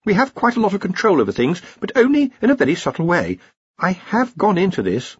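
high-voiced academic correcting his seniors; Ponder, earnest junior wizard. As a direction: explain patiently in a high voice.

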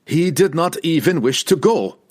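loud, high-pitched retort; forceful, indignant contradiction; shot back. A narrator's reading. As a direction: fire it back angrily. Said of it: "Wulfgar shot back".

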